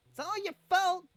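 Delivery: high, loud furious accusation; angry yelling. Acted. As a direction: scream the blame at him. Ruff yells at Hans.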